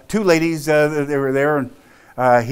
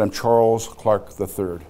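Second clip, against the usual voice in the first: slow, measured waspy introduction; stiffly proper, drawn-out self-introduction; 3 semitones lower, slower than usual. Retold or imitated. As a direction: give your full name slowly, with waspy formality. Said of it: "I gave a very waspy answer".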